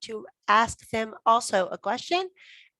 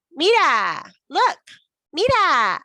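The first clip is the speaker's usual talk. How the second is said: loud, high, emphatic repetition drill; teacher drilling words loudly for the class; 8 semitones higher, 7 dB louder, slower than usual. Shown as a demonstration. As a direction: call out each word like a drill leader. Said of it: "You have to say it lots of times".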